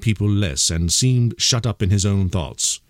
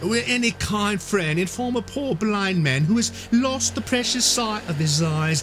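odd sing-song; 13 semitones higher than usual. Acted. Blind beggar calls out to the air in sing-song. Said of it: "raising his voice in an odd sing-song".

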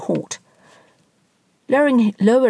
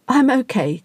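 quavering; 6 semitones higher than usual. Acted. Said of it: "quavered the man".

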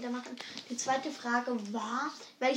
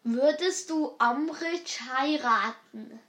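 drawn-out words so "Würdest" can be read off the lips; slow, exaggerated mouthing; slower than usual. Real, not acted. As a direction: spell each word out slowly for a lip-reader. Asks slowly so the partner can lip-read.

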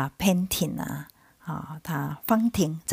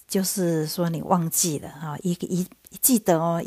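ordinary delivery

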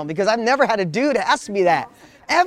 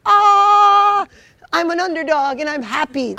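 much higher, louder, hammed-up joyful revelation; mock epiphany; 10 semitones higher, 4 dB louder, slower than usual. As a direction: play the kid who suddenly sees the light, overdone. Mock epiphany, sky parting.

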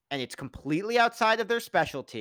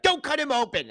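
mock shout; 10 semitones higher, 4 dB louder than usual. House rushing into operating room.

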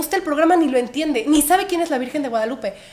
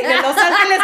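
ordinary talk

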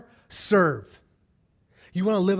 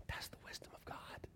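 barely-there whisper; hushed awe; 23 dB quieter than usual. Real, not acted. Preacher landing his point on God's wisdom, whispered. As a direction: whisper it like a revelation. Hushed refrain, then repeated aloud.

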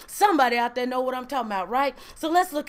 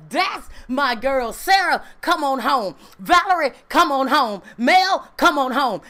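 calling out; 4 dB louder than usual. Pretend. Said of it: "looks down the road and says".

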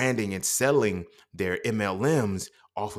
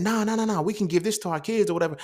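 retelling his own argument, high and insistent; excited protest; 11 semitones higher than usual. Retold or imitated. Arguing back against the dude.

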